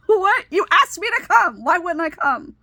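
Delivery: high and loud disbelief, easing down by the end; genuinely thrown by the odd remark; baffled protest. Retold or imitated. To school staff surprised parents came, a high, loud baffled what.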